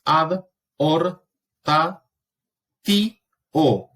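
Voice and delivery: "ad, or, ta, ti, o" with a clear pause between each syllable. Shown as a demonstration. Teacher splitting a Latin word into syllables for students. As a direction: break the word into its syllables and say each one apart. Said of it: "por eso lo separo".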